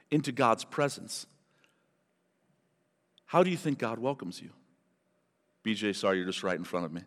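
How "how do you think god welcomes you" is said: ordinary talk, nothing marked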